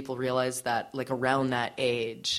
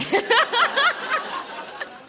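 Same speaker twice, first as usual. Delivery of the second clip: squealing laugh, much higher; delighted high-pitched laugh; high burst of laughter; 5 dB louder than usual. Real, not acted. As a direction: crack up at the memory. Laughs at her teenage self.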